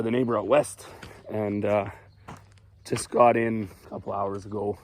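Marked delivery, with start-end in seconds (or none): none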